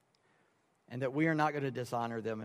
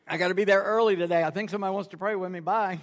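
fast, raised mock excuse; hurried excuse; 4 semitones higher, 5 dB louder, faster than usual. Pastor dodging kid duty with his wife, hurried and breezy. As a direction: rattle it off and make a getaway. Rushed excuse to leave early.

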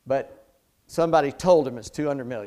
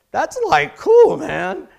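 much higher, louder laid-back hippie drawl; beatnik Shaggy voice; 14 semitones higher, 11 dB louder, slower than usual. Retold or imitated. Preacher imitating Shaggy saying like, high and loud. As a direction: ham up the cool beatnik. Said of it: "He uses the word like".

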